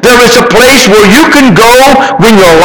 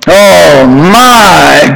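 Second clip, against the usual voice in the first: normal talking voice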